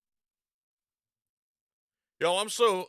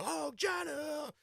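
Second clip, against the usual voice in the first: mock Oh Johnny; 8 semitones higher, 7 dB quieter, slower than usual. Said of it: "I've been saying it like".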